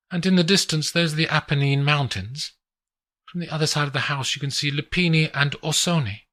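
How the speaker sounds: woman showing off the view; Adelina's voice. Acted.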